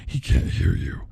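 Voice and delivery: creepy villain. Acted. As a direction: let it drop low and cold. Freddy mocking her cries.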